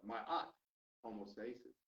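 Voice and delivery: usual speaking voice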